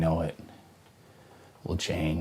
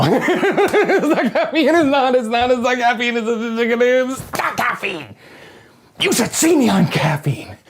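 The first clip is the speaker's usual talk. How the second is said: very high, loud, laughing protest; laughing manic denial; 7 dB louder, slower than usual. Acted. Insisting to viewers it isn't caffeine, laughing.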